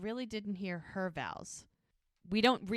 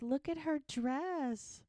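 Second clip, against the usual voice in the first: higher, slow, gushing admiration; drawn-out coo over a cute dress; 7 semitones higher, slower than usual. Retold or imitated.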